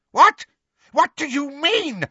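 very high, startled outburst; alarmed cry. A narrator's reading. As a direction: cry out with fear in the voice. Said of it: "she cried".

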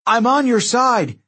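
high, drawn-out plea; urgent pleading. A narrator's reading. Demigod pleading with an angry mob, high and urgent. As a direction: call out desperately to be believed. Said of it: "He kept pleading".

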